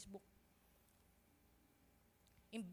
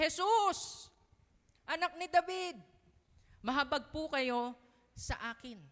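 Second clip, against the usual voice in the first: higher, louder cry to Jesus; loud faithful cry; 6 semitones higher, 8 dB louder than usual. Acted. Blind beggar not giving up on Jesus, loud desperate cry. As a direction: cry out with all your faith. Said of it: "Malakas yung pananampalataya niya".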